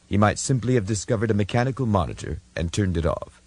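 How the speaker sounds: ordinary delivery, nothing marked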